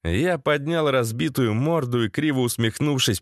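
ordinary delivery

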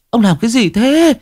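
put-on very high, frantic pleading of a terrified old wife; panicked wife. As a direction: cry out at him in alarm, rushing. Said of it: "Bà Đức kinh hãi".

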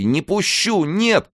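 very high, desperate cry. A narrator's reading. Wife refusing to let her husband go, crying out. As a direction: refuse loudly in a high wail. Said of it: "кричала она по-польски".

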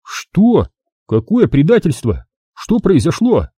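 bewildered high questions; stunned, not understanding; confused shock. A narrator's reading. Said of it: "растерянно заморгал глазами".

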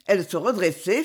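ordinary delivery, nothing marked

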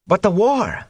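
high, eager interruption. A narrator's reading. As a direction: jump in eagerly. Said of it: "Mat began eagerly".

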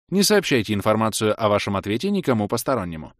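размеренный официальный голос автоинформатора, чуть приподнятый; объявляй как игровая система; системное объявление. Acted. (официальное системное сообщение)

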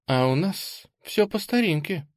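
showy sigh. A narrator's reading. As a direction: sigh for show. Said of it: "напоказ вздохнула Анфиса". (напоказ вздыхая)

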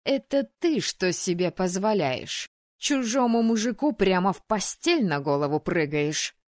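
loud jealous outburst; flaring up. Acted. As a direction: blow up at the rival. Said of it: "Опять взвилась на месте".

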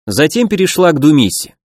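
plain reading voice

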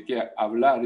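usual speaking voice

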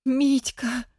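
high, sudden cry of joy; joyful cry. Acted. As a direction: burst out his name in relief. Wife sees husband return.

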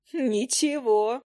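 high, very drawn-out playful word; giggly fairy. A narrator's reading. Fairy teasing the interrupted hunter, giggly. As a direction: sing it out high and stretched, impish. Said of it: "хикнула Файка".

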